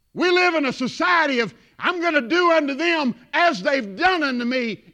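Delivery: loud, high pulpit voice; forceful preaching. Real, not acted. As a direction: hammer it out to the congregation.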